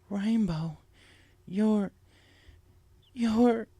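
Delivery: trying to speak through tears, words stalling; choked up. Acted. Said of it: "couldn't even get the sentence out as tears streamed".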